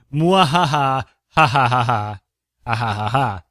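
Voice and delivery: hammed-up maniacal laugh; evil villain cackle. Villain laugh after plan.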